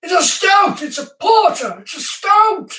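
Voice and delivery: high, loud squawk of arguing voices; mock screaming. Imitating viewers screaming their answers at the host, mock screaming. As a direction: yell it shrilly high, like outraged commenters. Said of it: "screaming at me".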